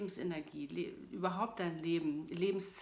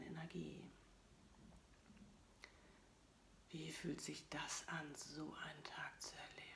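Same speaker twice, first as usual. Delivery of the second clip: soft spa whisper; 6 dB quieter than usual. Acted. Leads a guided meditation.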